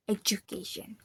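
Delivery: Education with D blended into "ju"; blended "ju" sound. Shown as a demonstration. Student applies the blend rule, "ju" in Education. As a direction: say Education as "eh-joo-kay-shun". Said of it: "blends and become ju sound".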